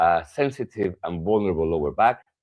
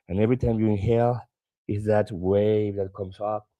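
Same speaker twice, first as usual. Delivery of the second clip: guided meditation; 3 semitones higher than usual. Acted. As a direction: lead them gently into the image. Guides ocean wave visualization.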